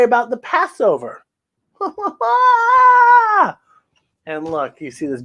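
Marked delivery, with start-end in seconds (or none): 1.8-3.5 high, loud belly laugh; hearty laugh. Acted. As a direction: laugh out in delight. Big laugh.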